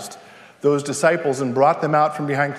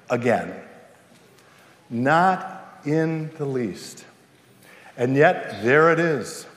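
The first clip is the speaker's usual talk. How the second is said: hushed reverence; 5 semitones lower, slower than usual. Acted. Quiet reverent preaching.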